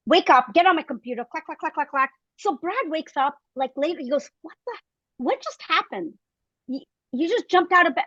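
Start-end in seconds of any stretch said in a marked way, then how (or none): 1.2-2.1 crisp imitated keyboard clacks, higher; typing clacks. 4.4-8.1 higher, startled mock husband; a husband just woken, baffled; surprised husband.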